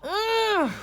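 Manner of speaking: high, loud sleepy ugh; groggy groan. A narrator's reading. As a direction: groan from under the covers. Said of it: "Gregory mumbled".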